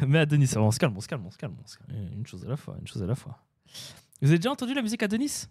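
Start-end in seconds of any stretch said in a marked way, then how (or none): 0.1-3.4 1 mock soothing. Mock calming an eager viewer.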